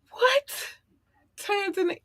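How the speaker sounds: tiny high squeak of disbelief; astonished. Real, not acted. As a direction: squeak it, fading on the country. Still amazed Tanzania is listening.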